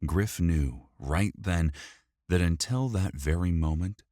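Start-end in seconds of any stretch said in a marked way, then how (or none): none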